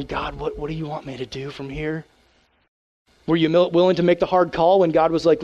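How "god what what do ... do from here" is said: quiet, humble asking of God; softly voiced prayer to God; hushed prayer. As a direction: murmur it like a private prayer.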